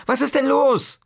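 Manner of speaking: high, loud worried call; mother shouting up to her frightened son; alarmed mother. Acted. Mama calls up, alarmed.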